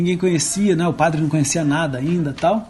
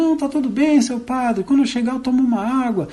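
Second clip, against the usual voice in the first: mule answering, lightly higher and quick; the little mule's voice; 7 semitones higher than usual. Acted. Ventriloquist priest as the mule, higher and quick. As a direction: play the mule, easygoing. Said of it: "Ele falou".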